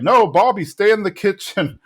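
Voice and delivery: mock-stern dad shooing the kid away; alarmed order. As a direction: order the kid to stay out, pitched high. Hank orders Bobby out of earshot.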